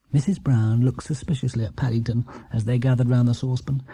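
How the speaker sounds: usual speaking voice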